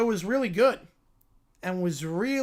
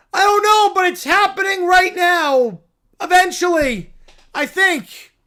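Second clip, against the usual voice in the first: a cub thrilled by the news; excited little bear; 8 semitones higher, 9 dB louder than usual. Acted. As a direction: squeal it like an overjoyed cub.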